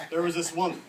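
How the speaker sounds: ordinary talk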